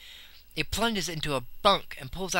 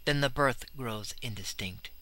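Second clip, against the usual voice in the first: plain reading voice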